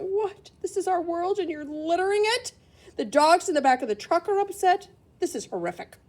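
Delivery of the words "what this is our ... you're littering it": animals complaining. Acted. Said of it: "the animals sitting there going".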